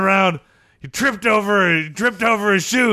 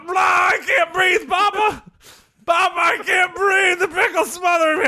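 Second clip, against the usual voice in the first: put-on child gasping; 11 semitones higher than usual. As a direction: play the crushed boy calling his papa. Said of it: "I heard Jimmy go".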